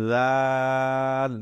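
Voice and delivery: slow "জাল" with a voiced "th" start; tongue tip to upper teeth, stretch "জাল". Shown as a demonstration. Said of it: "আগার সাথে লাগিয়ে".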